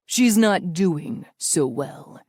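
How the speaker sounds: slow, smooth menace with a cruel smile; enjoy the threat slowly; sick amusement. A narrator's reading. Villain taunting Natalia about her aunt, amused and menacing. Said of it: "tinged with sick amusement".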